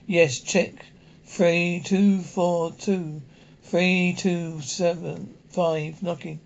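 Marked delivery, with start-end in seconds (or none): 1.2-6.0 each digit spaced out slowly; dictating a number slowly; slow number dictation. Acted. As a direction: read the numbers off one by one, slow and even.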